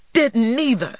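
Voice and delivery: high, loud protest; indignant denial. A narrator's reading. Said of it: "denied T J".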